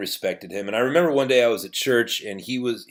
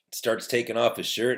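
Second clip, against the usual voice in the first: ordinary delivery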